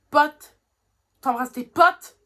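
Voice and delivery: loud, indignant questions; outraged disbelief. Real, not acted. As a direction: throw the word back at him, furious. Outraged at being called friends.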